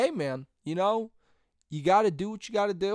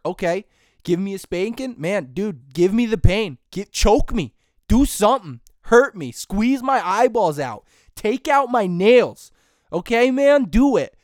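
loud, building mock challenge to do their worst; mock daring shout; 6 dB louder than usual. Daring his parents to punish him, hammed-up loud challenge. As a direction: yell it like a hammed-up tough guy begging for pain.

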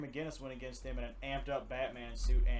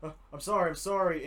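higher and louder, stammering sorry; mock frantic apology; panicked apology; 4 semitones higher, 5 dB louder than usual. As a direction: stammer it out in shock. Damian panicking after accident.

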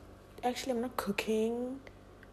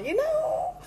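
squeaky cry. Acted.

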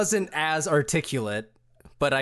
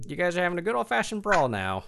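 ordinary delivery

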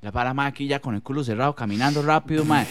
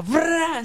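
high, comic burst "fra"; imitated splattering noise; 14 semitones higher than usual. Storyteller imitates losing the battle, explosive noise. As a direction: spit out a high sudden splatter sound.

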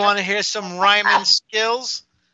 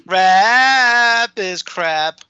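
drawn-out declaration; 4 semitones higher, 6 dB louder, much slower than usual. Pretend. Mock proclamation in argument.

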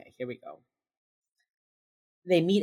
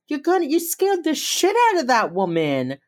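loud, high-pitched exasperated scolding; acted berating; 10 semitones higher, 8 dB louder than usual. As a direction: tell him off, appalled, voice way up. Acted colleague berating Reed.

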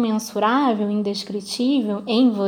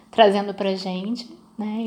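ordinary delivery

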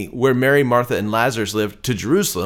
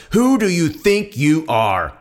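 slow, drawn-out indignant question; indignant challenge; 4 semitones higher, slower than usual. A narrator's reading. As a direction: challenge them, stretching each word. Crowd challenges Jesus.

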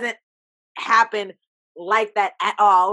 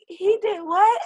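disbelief; 11 semitones higher, 4 dB louder, slower than usual. Retold or imitated. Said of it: "And I just was like".

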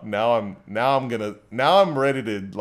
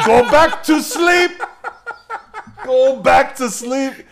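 mocking taunt; 9 semitones higher, 8 dB louder, slower than usual.

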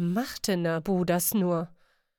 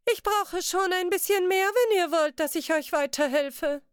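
greedy merchant demands more, voice climbing high; voice rising higher; 8 semitones higher than usual. Acted. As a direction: push the fussy merchant's voice up into a squeak. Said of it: "Seine ohnehin schon hohe Stimme wurde noch höher".

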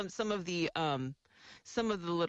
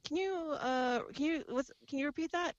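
confused mainlander; 8 semitones higher than usual. Retold or imitated. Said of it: "So I was like".

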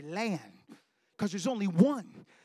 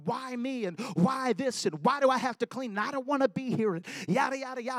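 crying out to God; 5 semitones higher, 5 dB louder than usual. Retold or imitated. Preacher retelling his prayer-room outburst, pleading louder. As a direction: pour the complaint out to God. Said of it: "I remember crying out to God".

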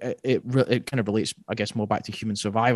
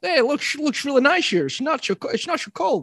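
high French sailor voice; 13 semitones higher than usual. Retold or imitated.

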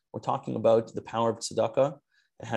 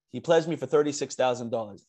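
ordinary talk

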